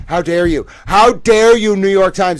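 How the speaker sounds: high, loud outraged how dare you; furious shout. Real, not acted. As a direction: yell it at the paper, outraged. Yelling at the newspaper.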